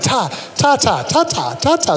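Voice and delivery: soft unvoiced "ta, ta" babble, tongue behind teeth; gentle quiet "ta" babble demo; baby babbling ta. Shown as a demonstration. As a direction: tap out "ta" like an infant experimenting. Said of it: "a T sound".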